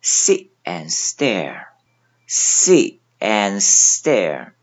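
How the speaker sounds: sit and stare with the t and d silent, only the mouth shape; 吞音示范; swallowed t and d. Shown as a demonstration. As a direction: leave out the t of sit and the d of and. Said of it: "这里边有两个吞音".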